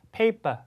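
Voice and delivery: Paper ending in an open "pa", no R; British, no R. Shown as a demonstration. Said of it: "영국 사람 발음들은 R 사운드를 하지 않는다". (Paper 끝 R을 빼는 영국식 발음)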